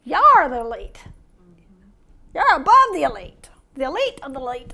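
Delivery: the president's way of talking. Retold or imitated. Preacher mocking how the president talks, lightly imitated. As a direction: mimic the president hyping a crowd. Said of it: "You know how he talks?".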